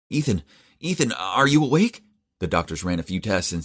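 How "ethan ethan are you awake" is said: much higher, eager call; hopeful calling. A narrator's reading. Narrator calling to waking Ethan, hopeful and eager. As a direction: urgently call his name.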